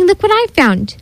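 excited, high-pitched discovery; happy cry. A narrator's reading. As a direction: show off a find with glee. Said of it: "she cried out happily".

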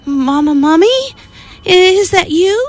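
put-on high hopeful child's question; hopeful call. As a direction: rise high, hoping it's mama. Baby hears noise in cave.

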